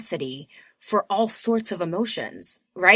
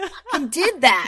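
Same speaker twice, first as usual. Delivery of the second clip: proud exclamation; 8 semitones higher, 8 dB louder than usual. Retold or imitated. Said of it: "you're like".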